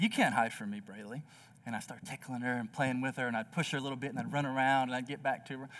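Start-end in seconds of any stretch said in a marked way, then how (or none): 0.0-1.2 playful daddy. Retold or imitated. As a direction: tease her gently like a game. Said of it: "I was like".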